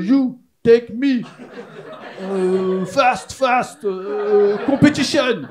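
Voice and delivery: put-on loud, high broken English with thick Marseille accent. Marseillais hitchhiker pleading abroad, shouted Marseille-accented English. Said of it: "c'est des Marseillais, ils font pas de phrases".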